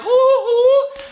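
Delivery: high, loud giddy whoops; giddy hoo. Real, not acted. Gushes about favorite booktubers.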